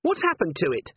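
high, still rattled question; a touch of panic, easing; panic receding. A narrator's reading. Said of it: "her panic receding a little".

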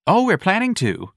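high, smiling lilt; bright smile. Acted. Young man taunting Ignatius, bright smiling lilt. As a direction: say it grinning. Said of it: "said with a bright smile".

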